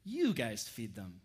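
slow, leaning heavily on "You"; stress on YOU. Shown as a demonstration. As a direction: punch "You" at the start. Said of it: "the emphasis is on you".

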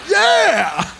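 high, excited yelp of mock triumph; mock cheer. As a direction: cheer like a proud dad, laughing. Father's mock cheer at son.

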